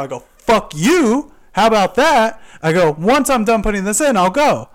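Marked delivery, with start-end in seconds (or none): 0.4-2.4 loud, high comeback; angry defiance. Retold or imitated. Acting out his defiant retort. 3.0-4.7 loud, raised insistence; defiant retort. Retold or imitated. Acting out his defiant retort.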